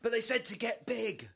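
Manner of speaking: high-pitched indignant cry; mock desperate protest, pitched high; hammed-up protest. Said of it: "and be like".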